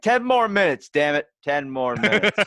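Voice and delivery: high, loud mock-frantic plea; yelling back. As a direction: holler it like an interrupted teenager.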